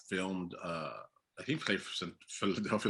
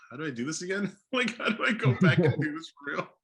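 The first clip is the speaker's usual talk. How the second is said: laughing; 8 semitones higher, 5 dB louder than usual. Retold or imitated. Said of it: "'cause now I'm like".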